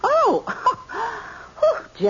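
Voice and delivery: high startled gasp and laugh; jump, then laugh it off breathlessly. Acted. Mother startled by her son while holding dishes, high gasp.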